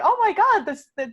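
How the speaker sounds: ordinary delivery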